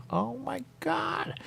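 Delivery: high, slow, drawn-out oh my God; exasperated groan. Real, not acted. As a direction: stretch it in mock despair. Exasperated at herself.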